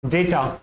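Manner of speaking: data said as deɪta, long a; data as day-ta, not dah-ta. Shown as a demonstration. Teacher giving the correct data. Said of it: "the correct pronunciation of this word is".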